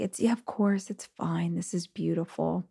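acted hushed, reassuring aside; soft reassurance. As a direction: softly play down the tears, as if to a child. Reassuring her crying son in a story.

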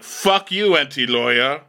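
high, punched retort; mock defiance. [name] answering the gossip blog, mock defiant retort.

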